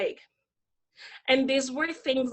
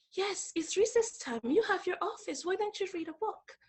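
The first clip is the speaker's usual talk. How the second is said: higher, hushed polite call; imitating quiet boss; 7 semitones higher, 8 dB quieter than usual. Boss calling her teacher in from recess, hushed imitation. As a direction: mimic a boss calling softly across the yard. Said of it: "she said very quietly".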